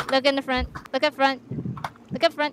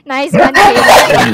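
loud teasing; 7 dB louder, slower than usual. Acted. Teasing a silent teammate.

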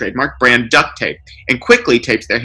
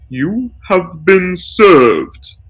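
slow, higher, deliberate legal pronouncement; slow pompous lawyer; 7 semitones higher, much slower than usual. A narrator's reading. Lawyer slams a document on the kid's shoulder, slow and grand. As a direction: savour each word like a verdict.

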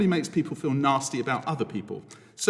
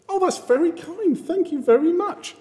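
much higher, sweet grateful voice; delighted thanks; 12 semitones higher than usual. Acted. The mirror friend offered chocolate, high delighted thanks. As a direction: gush your thanks in a high voice.

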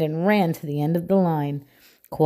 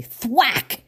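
plain reading voice

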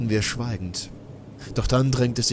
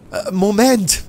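higher and louder, a quick startled "Äh, Moment"; startled call; 11 semitones higher, 10 dB louder than usual. Acted. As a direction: call out to stop him, caught off guard. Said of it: "rief ich und hob abwehrend beide Hände". (überraschter Ausruf, höher und lauter)

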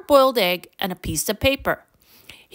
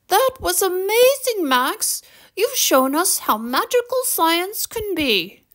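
high-pitched, gushing teacher praise; high, proud teacher; delighted teacher; 9 semitones higher than usual. A narrator's reading. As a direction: praise the pupil in a high, thrilled voice. Beaming teacher praises Max.

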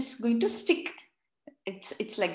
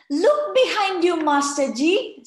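high, loud excited squeal; squealing children; 7 semitones higher, 9 dB louder than usual. A narrator's reading. Children warn teacher about frog, squealing high and loud. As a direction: squeal it like thrilled kids. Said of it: "said Bishal".

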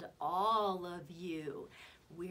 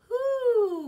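loud, high owl hoo; drawn-out hooting call; 14 dB louder than usual. Shown as a demonstration. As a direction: demonstrate an owl's hoot for children. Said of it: "wants to practice his".

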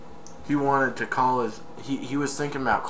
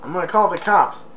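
put-on taunting kid; 8 semitones higher than usual. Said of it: "he said".

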